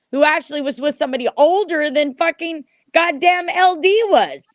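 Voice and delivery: high, heated challenge; real indignant outburst; angry rant. Real, not acted.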